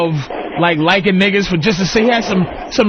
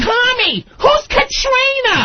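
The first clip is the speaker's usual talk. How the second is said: much higher, slow, shouted with a mock cry; shrill suspicious wife; 14 semitones higher, slower than usual. Wife confronting husband over Katrina, much higher mock shriek. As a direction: shriek it like a jealous wife catching him out. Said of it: "his wife goes".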